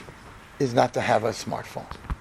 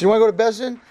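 challenging taunt; 7 semitones higher, 4 dB louder, faster than usual. Retold or imitated. Rosh Yeshiva challenges the boy.

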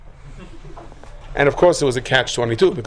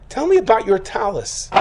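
higher, pressing the question hard; a barely contained mother-in-law demanding an answer; mock-furious demand; 10 semitones higher than usual. Mother-in-law grilling her son about his dirty talus, higher and demanding.

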